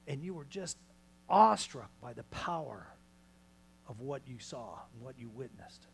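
ordinary delivery

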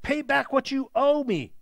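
a stern creditor's demand. A narrator's reading. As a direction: insist on being paid. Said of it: "he demanded".

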